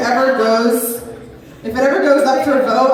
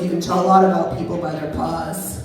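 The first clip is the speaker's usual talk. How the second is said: Doctor Doghouse quote; 6 semitones lower than usual. Pretend. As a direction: deliver it like a stoned sage vet. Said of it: "weird quotes".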